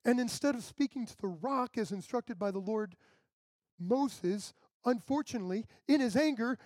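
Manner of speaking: ordinary delivery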